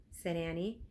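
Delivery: plain reading voice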